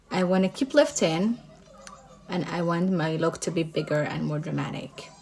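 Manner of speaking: usual speaking voice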